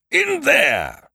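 high roared shout; roaring. A narrator's reading. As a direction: roar it at the door. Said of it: "roared the creditor".

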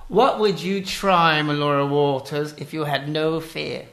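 put-on slow, theatrical English accent. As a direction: pose the question as a fancy English host. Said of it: "answer in an English accent".

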